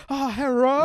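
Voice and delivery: high, loud mock of a friend answering the phone; Andrew's deep voice. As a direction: imitate his sincere phone greeting. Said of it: "he does the voice and then sincerely goes".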